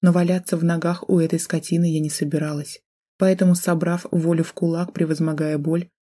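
ordinary delivery, nothing marked

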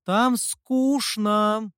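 high, drawn-out whine of boredom; slow, high, bored complaint; bored, yawning. A narrator's reading. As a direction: stretch it out as if through a yawn. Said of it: "демоница зевнула".

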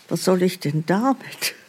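usual speaking voice

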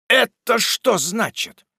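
slow, high-pitched question; drawn-out, indignant query; astonished demand. Acted. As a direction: demand an explanation in amazement. Host confronts man found hiding.

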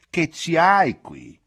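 loud, slow mock prodding of a girl; a slow loud teasing poke in words; teasing question. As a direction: knock on her head and ask it slowly, loudly. Old woman teasing, knocking forehead.

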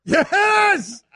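high, loud laughing yes; excited shout. Real, not acted. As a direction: burst out with joy. Reacting to Moist.

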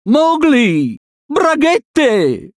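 high drawn-out call; long high-pitched holler. A narrator's reading. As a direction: call someone across a river. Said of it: "lo stavano chiamando".

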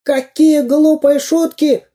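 very high, slow squawk; cartoon bandit's squealed complaint; indignant villain. A narrator's reading. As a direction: protest in outrage. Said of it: "возмутился Дырка".

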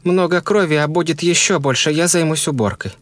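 high woman's voice, insisting; insistent wife. A narrator's reading.

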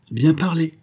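the actress applauding the abbé's choice, joyful; joyful cry. A narrator's reading. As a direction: cheer him on with delight. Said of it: "s'écria l'actrice avec joie".

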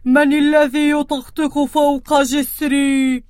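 slow, loud, menacing troll; scary troll voice. Acted. Troll challenging the second goat, slow and booming. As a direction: boom it out like an ugly troll. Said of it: "بصوته المخيف".

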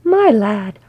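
loud, hearty greeting; booming, gushing welcome; effusive roar. A narrator's reading. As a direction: roar it out with delight. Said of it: "Oin roared effusively".

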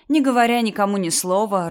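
usual speaking voice

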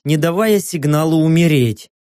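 ordinary delivery, nothing marked

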